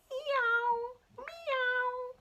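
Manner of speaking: high, drawn-out kitten meow; cat meow. Acted. Mog meowing from the rainy garden, high and plaintive. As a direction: mew like a small wet cat.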